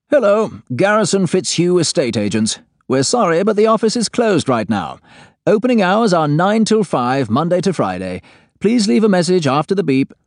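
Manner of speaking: recorded receptionist message, high voice; over a landline phone. Acted. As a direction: play it as a phone answering machine. Answering machine message on phone.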